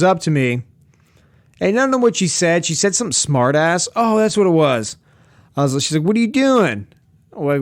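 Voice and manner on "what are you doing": high-pitched imitation of her question; indirect challenge. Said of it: "He's not that kind of overt".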